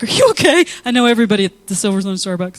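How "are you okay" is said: concerned barista. Retold or imitated. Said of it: "they're like".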